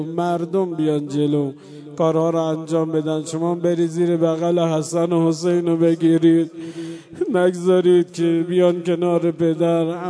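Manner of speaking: loud mourning sermon at full force, half sung; impassioned preaching. Acted. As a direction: cry it out to the mourners in the dark. Preacher returns to chanted lament.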